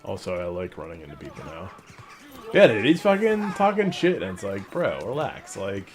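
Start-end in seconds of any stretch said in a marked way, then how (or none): 2.5-5.6 frat bro. Retold or imitated. Retelling kid talking trash.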